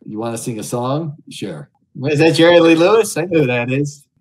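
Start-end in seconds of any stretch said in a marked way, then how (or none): 2.1-4.1 loud, high leering lounge-lizard greeting; sleazy greeter. Acted. Streamer greets Omegle strangers as a leering creep, loud and high. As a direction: belt it out like a creepy host hitting on strangers.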